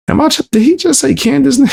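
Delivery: high, rushed incredulous question; mock disbelief. Host reacting to a name in the clip, incredulous. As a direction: pitch shoots up in quick astonishment.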